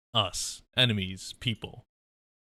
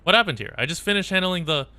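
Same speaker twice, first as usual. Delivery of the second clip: high, louder worried questions; worried soldier rushing in, raised and high; anxious operator; 7 semitones higher, 4 dB louder than usual. Acted. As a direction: burst in alarmed, pitched up. Voices character arriving on scene.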